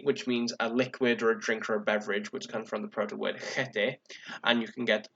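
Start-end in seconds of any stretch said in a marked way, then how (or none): none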